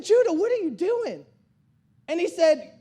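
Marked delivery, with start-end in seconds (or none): 0.0-1.2 high-pitched, incredulous dad; mock disbelief, pitched up; retold disbelief. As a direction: call the kid out in disbelief. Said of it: "I'm watching this in disbelief".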